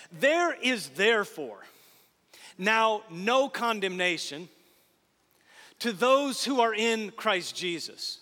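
slow, weighty reading with pauses between phrases; slow scripture reading. Shown as a demonstration. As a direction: lay each phrase down slowly, letting it sink in. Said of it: "This is refrigerator-worthy stuff".

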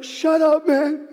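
mock irritation at the cheerleader; grumpy snap. Worn-out runner snapping at his cheering son, fed-up and loud. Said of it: "I'm like".

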